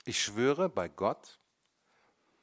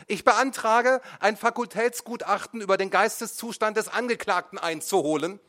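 high, loud, urgent demand; urgent motion; 13 semitones higher, 9 dB louder than usual. A narrator's reading. Defence lawyer jumps up demanding, high and loud. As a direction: leap up and cut in.

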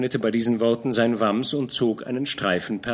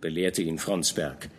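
usual speaking voice

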